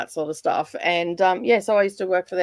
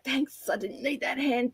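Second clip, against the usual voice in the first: sarcastic whispered aside; 7 semitones higher, 7 dB quieter than usual. Retold or imitated. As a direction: whisper it, dry and wry. Retold sarcastic thought at concert.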